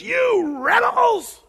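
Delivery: high, drawn-out furious yell; hammed-up outburst of rage; angry Moses. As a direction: bellow it at the crowd, stretched out. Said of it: "looks at the people and says".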